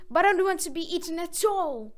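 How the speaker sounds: loud high protest; crying out. A narrator's reading. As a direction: cry it out in panic. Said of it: "cried Puppet".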